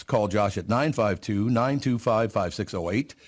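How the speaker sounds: upbeat radio ad read; confident radio sponsor read. Acted. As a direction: sell it like a trusted live read. Live ad read.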